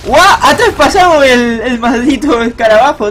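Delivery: loud, high "whoa" of amazement; astonished outburst. Real, not acted. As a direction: exclaim in excited disbelief. Startled by play in game.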